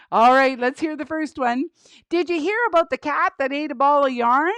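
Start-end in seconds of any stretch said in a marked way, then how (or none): 2.1-4.5 quick, lightly voiced puppet; puppet joke setup. Penny asks the host a cat joke, lightly voiced puppet. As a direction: pose the riddle as a puppet comic.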